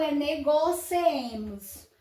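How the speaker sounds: negociemos said slowly, syllable by syllable; slow careful reading. Shown as a demonstration. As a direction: spell negociemos out slowly, as a model. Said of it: "Porque essa grafia está errada". (pronúncia lenta e cuidadosa de negociemos)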